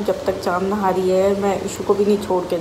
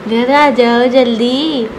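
put-on playful child voice; 5 semitones higher, 7 dB louder than usual. Voicing child to grandpa, playful.

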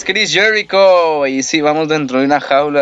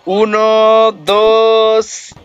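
excited count; 6 semitones higher, much slower than usual. Real, not acted. Counting the pin.

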